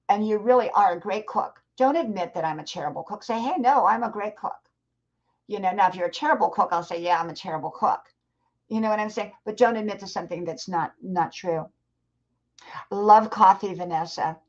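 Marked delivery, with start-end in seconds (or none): none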